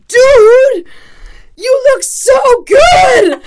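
very high, slow, drawn-out shout; excited shout. Acted. Friend hyping a man after his makeover, excited shout. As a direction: holler it high, stretching the words. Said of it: "he comes in and he's like".

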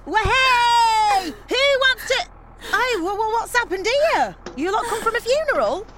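loud, high party-starter's holler; rowdy shouting. Acted. Organiser finding the mums subdued, loudly rowdy.

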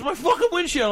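high, outraged wail. Retold or imitated. Friend to puncher, outraged wail. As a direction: moan it in disbelief over the glass. Friend pissed about windshield.

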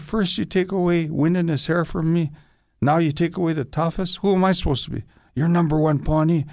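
indignant. Retold or imitated. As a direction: let the grievance build with each question. Complaining his role was taken.

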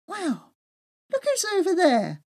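high, bright exclamation; excited surprise. Acted. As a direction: spot old friends across the camp. She exclaims, spotting friends.

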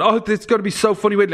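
high, fast gleeful build-up; excitedly talking up a show; excited hype. Retold or imitated. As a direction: re-enact eager hyping, rushed and high-pitched. Re-enacts hyping it to someone.

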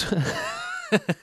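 high burst of laughter; real laugh. Real, not acted. Host laughing after reading ahead in the ad, real laugh. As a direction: crack up helplessly mid-read. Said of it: "sorry, I laughed 'cause I read ahead".

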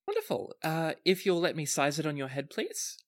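bright shop lady pleased with a sale; lightly voiced haberdasher.

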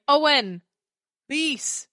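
loud, hammed-up bad Welsh accent calling names. Shown as a demonstration. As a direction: yell the names in a clumsy mock Welsh accent. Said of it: "in a Welsh accent that's very bad".

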